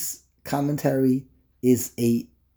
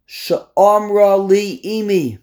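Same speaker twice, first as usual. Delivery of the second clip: slow verse quote; 5 semitones higher, 5 dB louder, much slower than usual. Acted. Quoting the verse in Hebrew.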